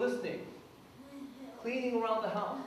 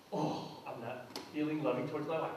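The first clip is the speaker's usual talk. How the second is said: mock sulky husband; 8 semitones lower, 7 dB quieter than usual. Imitating a reluctant husband.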